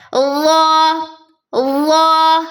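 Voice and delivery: ظا said thick and clear; letter name recited. Shown as a demonstration. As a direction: model ظا slowly. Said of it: "وا بھی دو حرفی ہے".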